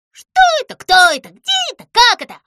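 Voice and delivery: very loud high cries; startled mice. A narrator's reading. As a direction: squeal in fright, confused. Mice jolted awake. (испуганный писк мышей)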